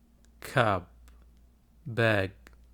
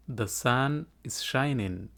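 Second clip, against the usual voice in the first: The sun is shining said smoothly as one phrase, very slow; slow whole sentence; much slower than usual. Shown as a demonstration. Teacher models the full sentence slowly for students to repeat. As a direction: glide through The sun is shining slowly without breaks. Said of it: "Iga daba dheh".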